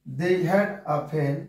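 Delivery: very slow, each word drawn out for writing; slow dictation. Shown as a demonstration. Teacher reads the example to students, slowly. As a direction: dictate they had a fan slowly. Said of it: "dictating example sentence".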